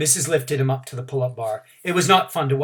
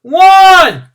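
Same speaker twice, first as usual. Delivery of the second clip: counting out much higher and louder; hollered count; 17 dB louder than usual. A narrator's reading. Teacher counting pull-ups out loud, hollering. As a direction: call the number across the playground. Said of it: "hollered roommate".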